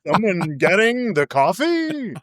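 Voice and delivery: hammed-up reporter voice used on a sleepy spouse; stilted newsmagazine voice. Reporter greeting his wife in the morning, mock stilted TV voice. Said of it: "Why is he talking like this?".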